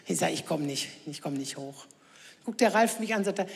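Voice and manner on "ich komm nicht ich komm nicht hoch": low and soft, too lazy to get up; lazy low mumble; low weary groan. Retold or imitated. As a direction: grumble it from the sofa, low and quiet. Mother groaning she can't get up.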